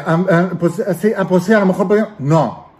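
stammering, unsure, caught off guard; flustered hesitant answer. Shown as a demonstration. Coach showing a rushed reactive reply, flustered and hesitant. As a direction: fumble for words like someone rushed into answering. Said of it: "Porque es muy diferente".

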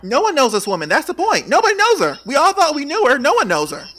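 high-pitched, insistent exclaiming; emphatic outburst. Real, not acted. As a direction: hammer the point home, voice climbing high. Heated rebuttal to co-host.

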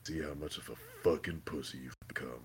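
low, soft mock sneer; Soldier Boy quote. Retelling Soldier Boy mocking his son, low soft scorn. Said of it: "He's like".

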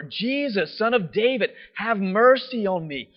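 high, loud, slow plea. Retold or imitated. Blind man crying out over the crowd, loud. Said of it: "he cried out all the louder".

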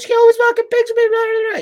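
very high, loud screeched complaint; mock yelling. Wife complains to big brother about her husband, mock screeching yell. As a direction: yell shrilly over everyone in a piled-up rant. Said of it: "Don't fucking yell at me".

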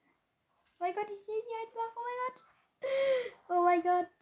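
freaking out at the enemy; panicking gamer. Real, not acted. Sees the enemy.

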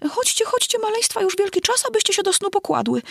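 very high, quick trembling chirp; trembling canary voice. A narrator's reading. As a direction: call the chicks in a tiny quavering voice. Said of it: "drżącym głosem nawoływał".

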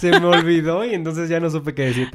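talking through real laughter; laughing. Real, not acted. Podcast host laughing at himself for forgetting what he wanted to say.

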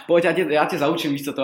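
lightly voiced bossy shop lady; imitating the coworker. Coworker mistakes him for a new hire, lightly mocking voice. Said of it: "A ona".

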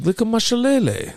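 high-pitched, badly faked English accent; mock English accent. Shown as a demonstration. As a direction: put on a hammed-up English accent, pitched well up. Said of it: "It's like English".

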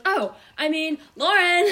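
high, loud mock holler, breaking into a laugh; flustered mom correcting herself. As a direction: imitate a mom catching her mix-up then yelling. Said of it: "And then she goes".

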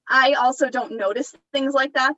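ordinary delivery

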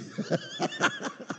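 a burst of high laughter; high giggling laugh. Real, not acted. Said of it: "That was how he laughed".

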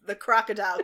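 usual speaking voice

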